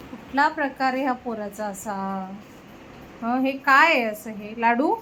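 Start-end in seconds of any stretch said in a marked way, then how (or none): none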